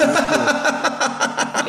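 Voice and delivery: high burst of giggling; giggling at "on the Twitter"; high laugh. Real, not acted. Mocking "on the Twitter.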